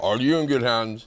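slow deep ad voice; reassuring insurance pitch; low slow commercial voice; Allstate guy impression. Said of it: "the Allstate guy".

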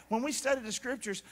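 ordinary delivery

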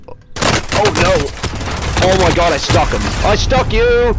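loud, high, triumphant yell; excited shouting. Real, not acted. Lands a sticky grenade hit.

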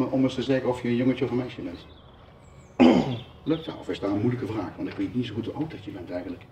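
ordinary talk, nothing marked

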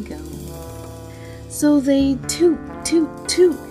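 usual speaking voice

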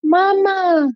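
put-on high, wailing little-boy cry of mamá; frightened small child calling mamá. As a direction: cry out like a scared toddler. Little boy points down the hallway.

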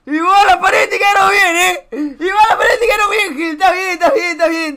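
loud, high repeated babble; high-pitched outcry. Acted. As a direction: cry it out high and insistently. Mimics chat in whiny high voice.

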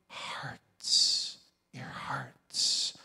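breathy hushed whisper on hearts. Acted. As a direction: drop to a reverent whisper. Whispers scripture ending.

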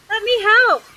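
high-pitched, keen offer; lightly excited storybook cry; eager cry. Acted. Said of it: "cried Little Miss Helpful".

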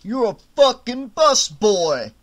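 slow, loud, sneering boss voice; a bully supervisor mocking the waiter; scornful Tony. A narrator's reading. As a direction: look down on him hard. Tony's voice.

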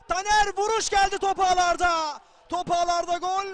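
full-throated goal yell; screaming football commentator. Real, not acted. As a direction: roar the goal call, loud and high. Goal scored, commentator yells.

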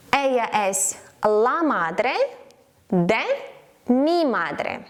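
slow Spanish, pausing between chunks; slow dictation. Shown as a demonstration. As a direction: give it piece by piece for learners. Slow model sentence for learners.

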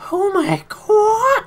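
very high, slow, shrieking cry; JonTron impression. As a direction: shriek it in a drawn-out falsetto. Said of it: "I can't do his voice".

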